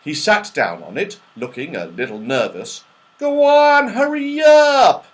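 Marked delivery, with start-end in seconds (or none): 3.2-5.1 very high, loud, slow hurry-up call; Moonface hurrying. Acted. Moonface urging the nervous rabbit down the slide, very high and loud. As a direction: push the rabbit along in a high shrill squeal. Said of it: "said Moonface".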